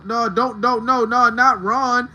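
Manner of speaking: loud, quick pleas of no; loud urgent plea to moderator Marge; urgent protest. Real, not acted. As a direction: stop her before she does it.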